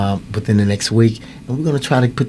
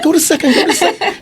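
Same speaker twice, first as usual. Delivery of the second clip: calling out. Retold or imitated. Coach calling to runner.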